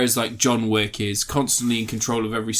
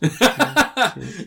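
laughing; 10 semitones higher, 4 dB louder than usual. Real, not acted. Laughing at friend's figure.

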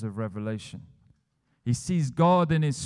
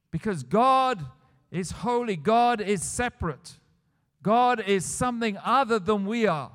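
loud, emphatic preacher, higher pitched; impassioned sermon climax, louder and higher; full-force preaching; 7 semitones higher, 5 dB louder than usual. Acted. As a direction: drive each line home from the pulpit.

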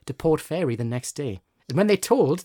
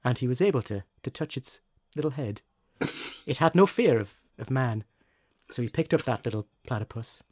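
fireside storytelling; 4 semitones lower than usual. Acted. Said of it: "You've got your storytelling voice on".

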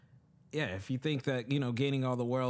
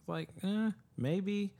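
doubtful shrug; 9 semitones higher, much slower than usual. Real, not acted. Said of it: "doubtful shrug".

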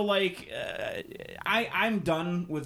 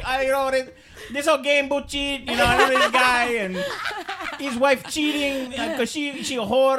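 much higher and louder hammed-up Ozzie Guillen accent, with laughter; a rambling Ozzie Guillen accent, laughing; 10 semitones higher, 9 dB louder than usual. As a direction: gossip like a mock Ozzie Guillen on TV. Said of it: "heard Ozzy speak".

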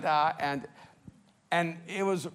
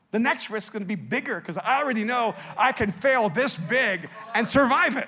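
louder and higher, building to a ringing finish; rising preaching fervor; 6 semitones higher, 7 dB louder than usual. Real, not acted. Pastor declaring to his congregation, loud and rising. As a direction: drive it home with a preacher's full conviction. Preaching climax about risk.

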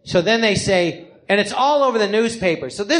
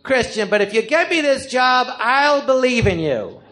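desperate plea; 5 semitones higher than usual. Retold or imitated. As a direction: beg Jesus for the job, pitched up. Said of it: "So I go in and I say".

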